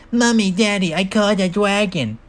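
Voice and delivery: put-on high little-kid voice calling out; spoiled kid calling. Spider child calling parents off screen, spoiled kid voice. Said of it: "Calling off screen".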